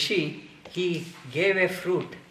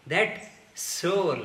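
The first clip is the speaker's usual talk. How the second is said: ordinary talk, nothing marked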